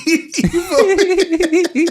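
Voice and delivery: high, slow laugh through words; laughing while asking. Real, not acted. Laughing at own joke.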